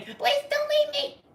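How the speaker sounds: high mock plea to her father; pleading girl voice. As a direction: beg like a scared little girl.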